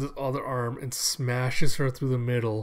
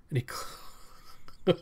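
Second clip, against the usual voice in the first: breaks up into high, breathless laughter; can't finish for laughing; real laughter; 6 semitones higher, 6 dB quieter, much slower than usual. Real, not acted. Narrator cracking up at the rocket hammer, helpless laughter.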